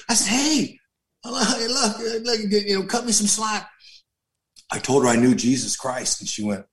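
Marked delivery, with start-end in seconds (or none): 0.3-3.7 higher, hurried plea for slack; a touch of playful begging; lightly hammed protest, pitched up; mock pleading. Pleading his case with her.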